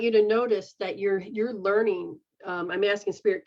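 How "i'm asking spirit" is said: ordinary delivery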